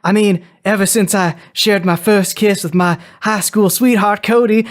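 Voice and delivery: wistful Southern belle explaining herself; Rogue. Acted. Wife brings up her powers and first kiss, Southern accent. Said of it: "This is all accent hour".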